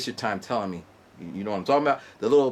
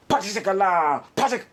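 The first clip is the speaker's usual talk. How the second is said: 1 sound effect; 8 semitones higher, 4 dB louder than usual. Retold or imitated. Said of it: "he goes".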